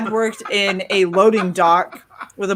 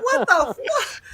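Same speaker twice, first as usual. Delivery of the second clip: incredulous; 13 semitones higher, much slower than usual. Retold or imitated. Her outraged reaction retold.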